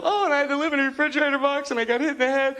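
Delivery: high, fast mock tale of woe; distraught talk-show guest. Guest pouring out hardship to Phil, mock distraught. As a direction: pile up your miseries in a high voice.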